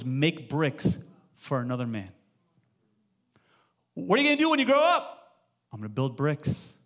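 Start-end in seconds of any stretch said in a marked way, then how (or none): 3.9-5.4 much higher, chirpy questioning voice; mock adult asking. Pastor voices an adult asking an Israelite kid, mock cheerful. 5.7-6.7 lower, softer, deadpan; mock resigned answer. Pastor voices an Israelite kid answering, deadpan.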